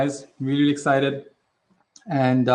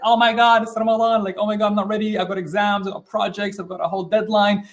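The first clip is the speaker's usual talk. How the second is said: higher-pitched, panicked rush of complaints; mock panic; 4 semitones higher than usual. Teacher imitating people dreading Ramadan's arrival, mock panic. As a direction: act out someone panicking, voice raised in pitch. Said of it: "like, a panic".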